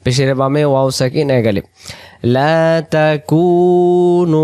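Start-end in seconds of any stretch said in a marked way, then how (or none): 2.3-4.4 slow melodic chant; Quran recitation. Acted. Teacher reciting the verse for the class. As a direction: draw the phrase out like tilawat.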